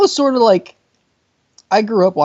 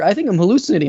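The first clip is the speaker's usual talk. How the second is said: ordinary delivery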